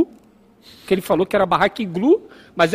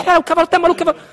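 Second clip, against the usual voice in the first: high, loud panicked shout; hammed-up cry of alarm; mock alarm; 8 semitones higher, 6 dB louder, much faster than usual. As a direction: holler in retold panic. Hippie shouting about crazed horse.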